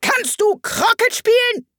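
very high, yelled question; piercing queen's voice; shrieking. A narrator's reading. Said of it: "schrie die Königin".

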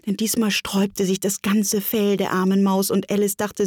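ordinary delivery

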